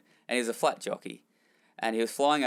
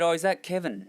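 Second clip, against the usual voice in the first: put-on Irish accent; 7 semitones higher than usual. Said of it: "in his very thick Irish accent".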